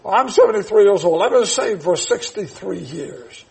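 ordinary talk, nothing marked

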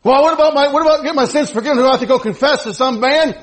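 loud, high-pitched sermon delivery; full-force pulpit proclamation; impassioned preaching. Acted. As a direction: preach it hard, driving each point home.

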